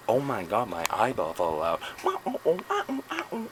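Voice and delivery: high squealing oh-oh-oh after a mock cry; hammed-up eyeball panic, squeaking. Vlogger hamming up a lost eyeball, squealing mock panic. Repeated acted eyeball bit.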